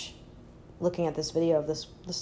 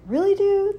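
incredulous; 13 semitones higher, 4 dB louder than usual. Acted. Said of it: "that's kind of my way of looking at it".

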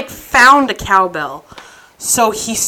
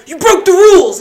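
raised, louder indignant retort; mock accusation; 9 semitones higher, 6 dB louder than usual. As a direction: imitate an outraged rule enforcer. Acting out mock scuffle accusation.

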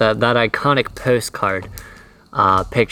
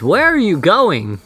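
calling out; 9 semitones higher, 4 dB louder than usual. Real, not acted. Friend wandering off into woods.

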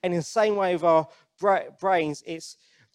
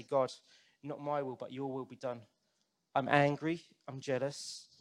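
soft, lowered voice of confession; quiet prayer; 3 semitones lower, 8 dB quieter than usual. Acted. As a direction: pray it softly, as a private surrender to God. Voicing a humble confession to God.

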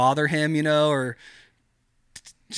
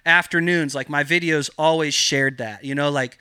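usual speaking voice

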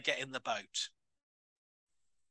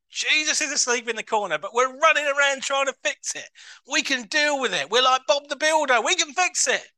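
higher, louder building preaching; rising fervour; 8 semitones higher, 7 dB louder than usual. Real, not acted. Preacher mocking our fixing, building high and loud. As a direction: build to a triumphant can-do cry.

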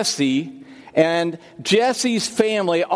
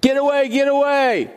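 retold scream; 9 semitones higher than usual. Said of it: "is screaming".

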